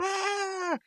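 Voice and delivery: very high, thin yelp without much volume; mock scalded scream. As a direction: imitate a cartoon shriek of pain, held back. Acts out Rigby being scalded.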